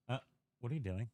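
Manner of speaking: ordinary talk, nothing marked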